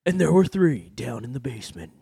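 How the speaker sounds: storyteller intoning a legend, fading to whisper; mock epic narration. Host spinning a legend about eye rolling, mock-epic.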